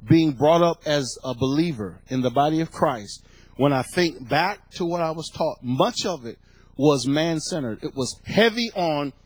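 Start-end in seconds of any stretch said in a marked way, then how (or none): none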